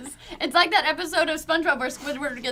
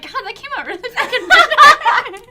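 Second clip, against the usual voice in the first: mock exasperated thought; 9 semitones higher, 10 dB louder than usual. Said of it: "As soon as I said that, I was like".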